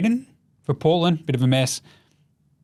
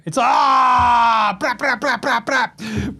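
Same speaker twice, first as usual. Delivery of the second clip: imitated gunfire; 8 semitones higher, much slower than usual. Hosts celebrate a savage reply with mock gunfire sounds. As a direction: rattle off fake machine-gun noises.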